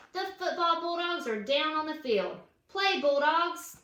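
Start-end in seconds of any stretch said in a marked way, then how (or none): none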